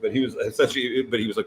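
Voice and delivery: ordinary talk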